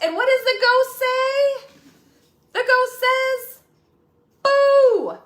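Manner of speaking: high, loud spooky question and Boo; ghost voice. Acted. Teacher asks kids what ghost says, high spooky. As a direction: ham up the ghost.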